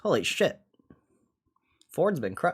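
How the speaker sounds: ordinary talk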